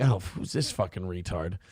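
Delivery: low, soft, dismissive aside; muttered contempt. Acted. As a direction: write him off under your breath. Mimics a dismissive listener.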